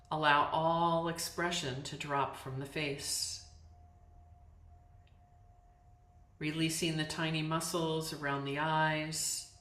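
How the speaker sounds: calm, unhurried meditation voice with long pauses; guided yoga nidra. Real, not acted. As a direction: guide them into letting go, phrase by phrase. Leads yoga nidra practice.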